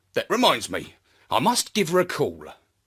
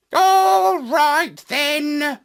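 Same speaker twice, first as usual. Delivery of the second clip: much higher, louder squawky parrot; 11 semitones higher, 5 dB louder, much slower than usual. A narrator's reading. The parrot giving in to his friend, high and loud. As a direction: give in as the parrot, pitched way up.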